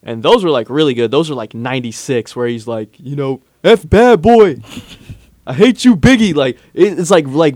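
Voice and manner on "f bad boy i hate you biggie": loud, aggressive rant impression; raised, hard-hitting mock anger. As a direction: spit it out angrily at a rival. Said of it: "very angry and aggressive almost".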